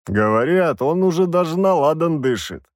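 hearty soldier boasting to the mage; бравый воевода. Acted. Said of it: "пробасил воевода". (бравый голос воеводы)

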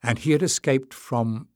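ordinary delivery, nothing marked

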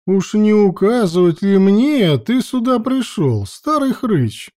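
high, haughty and scornful. A narrator's reading. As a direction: look down on him as you ask. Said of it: "высокомерно осведомилась Локша".

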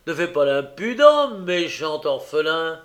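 lancer « bâtard » fort et lentement, plus aigu; insulte méprisante. A narrator's reading. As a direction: cracher l'injure à l'orphelin. Noé insulte l'orphelin. (gamin méchant qui insulte, fort et lent)

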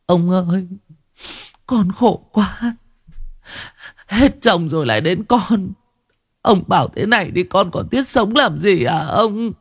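high, feeble lament of a grieving mother; high, faint grieving woman's voice. A narrator's reading. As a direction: plead weakly to the old man in grief. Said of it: "thều thào".

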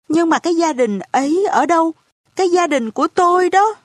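hỏi dồn, nhanh và cao giọng; hổn hển vội vã hỏi. A narrator's reading. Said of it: "Tôi hỗn hển hỏi". (cậu bé hổn hển hỏi bà cụ về gia đình)

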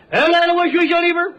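Shandong dialect greeting; imitated Shandong shopkeeper; Shandong butcher. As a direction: hail a customer in thick Shandong speech. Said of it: "我论着管他叫张大爷".

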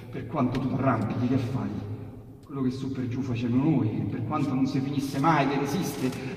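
ordinary delivery

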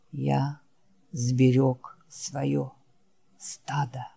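very slow, soft, every word set down; proud last words, each one placed with effort; carefully spoken words. Acted. Dying Wolf telling Jack he saved his herd, slow and faint. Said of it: "тщательно выговаривая каждое слово".

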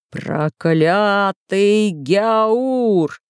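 very drawn-out, furious curse; enraged cry. A narrator's reading. Sultan cursing the prisoner, long drawn-out angry cry. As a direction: stretch each word of the curse in anger. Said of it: "закричал султан Саладин".

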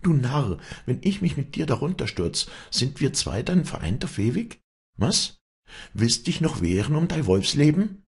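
high, mocking challenge; scornful. A narrator's reading. Walli taunts Vinzenz at the railing, high and scornful. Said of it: "schlug wieder ihre schreckliche Hohnlache auf".

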